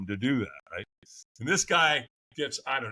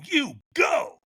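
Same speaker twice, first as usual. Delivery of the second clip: retold command; 10 semitones higher than usual. Mysterious stranger's command acted out.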